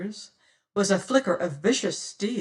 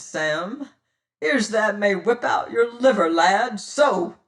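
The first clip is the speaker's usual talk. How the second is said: taunting pirate captain; 5 dB louder than usual. Acted. Pirate captain taunting the terrified boatswain, cruel and mocking. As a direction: savour the threat.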